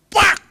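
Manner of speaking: sharp loud clap sound "pak"; loud mock slap noise; imitated slap. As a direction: smack it out like a hand slap. Slapping mosquito sound.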